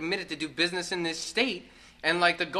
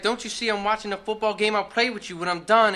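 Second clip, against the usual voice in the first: louder, higher, irritated dad brushing off his son; annoyed dad; 5 semitones higher, 8 dB louder than usual. Retold or imitated. Dad on the couch telling his son to wait, annoyed and louder. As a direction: snap back at the kid for interrupting the game. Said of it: "main man's like".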